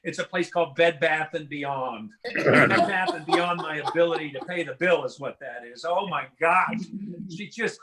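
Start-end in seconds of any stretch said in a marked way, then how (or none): none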